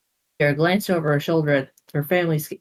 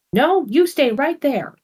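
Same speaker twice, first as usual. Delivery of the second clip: high, slow firm order; Sarah ordering Boris to stay; 9 semitones higher, slower than usual. Acted. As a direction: command him to stay put. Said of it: "ordered Sarah".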